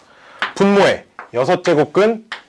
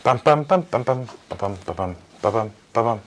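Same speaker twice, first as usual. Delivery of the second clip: low, soft "bbam-bbam" sounds; rhythmic vocal filler; 8 semitones lower, 7 dB quieter than usual. Real, not acted. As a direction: absently sound out a quiet little rhythm. Filler sounds between problems. (낮고 작게 빰빰 흥얼거리기)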